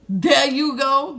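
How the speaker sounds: slow, loud pointed delivery; sarcastic handover. Retold or imitated. Handing the check to the rude cashier, slow sarcastic push. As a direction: drag it out with attitude.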